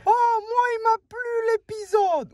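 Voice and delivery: voix très aiguë et forte, surjouée; spectateur enthousiaste imité. Acted. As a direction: imite un fan qui s'extasie à tue-tête. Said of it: "vous nous dites". (imitation criarde d'un fan ravi)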